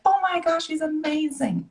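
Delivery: high, soft dreamy gush; swooning. Retold or imitated. Bride gushing about husband at wedding, high and dreamy. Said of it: "I'm like".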